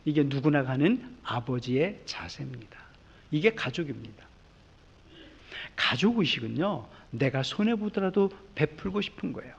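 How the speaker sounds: quiet sincere conclusion; tender sermon close. Acted. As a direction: speak it gently to the family.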